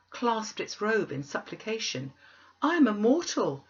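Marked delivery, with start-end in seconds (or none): 2.6-3.6 higher, louder protest; remonstrating. A narrator's reading. Scrooge protesting to the ghost at the window, raised remonstrance. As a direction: plead your case against the spirit, raised and urgent.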